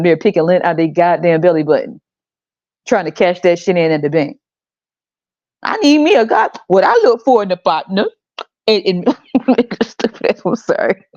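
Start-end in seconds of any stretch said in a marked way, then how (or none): 5.6-6.5 high, prim mock voice; mocking prissy woman. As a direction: pitch it way up like a pious girl. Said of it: "Making them stupid ass faces".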